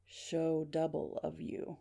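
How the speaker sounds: slow, soft, measured voice; eerie, subdued phone voice; mysterious caller. Acted. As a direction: intone each word slowly and quietly.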